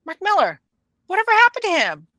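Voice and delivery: high, offhand, indifferent; as if he'd been forgotten; dismissive shrug. Retold or imitated. Host imagines readers shrugging Millar off, high and dismissive. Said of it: "I was like".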